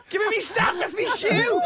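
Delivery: high, laughing voice of the bloke; squeaky outrage over a stolen shoe; mock Brisbane bloke. Comedian voicing the tackler grabbing his shoe back, high mock outrage.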